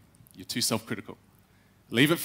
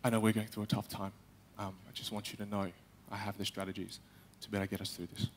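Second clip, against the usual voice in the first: very soft, low, timid address to the staff; unconfident CEO speech; 7 semitones lower, 15 dB quieter than usual. Retold or imitated. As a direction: say it as if nobody will believe you. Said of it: "He didn't sound confident".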